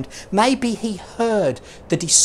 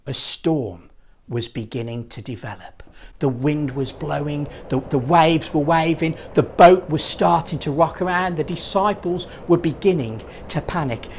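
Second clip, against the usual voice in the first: ordinary delivery, nothing marked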